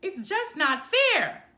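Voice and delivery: full loud outraged yell. A narrator's reading. Children in cribs yelling at parents, loud outrage. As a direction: shout it at the top of the lungs. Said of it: "they yelled from their cribs".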